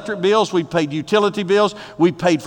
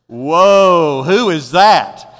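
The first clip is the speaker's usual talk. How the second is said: loud, drawn-out whoa, who is that; a guy stunned by a pretty woman; modern guy's whoa; 6 dB louder, much slower than usual. Retold or imitated. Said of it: "That's our vernacular".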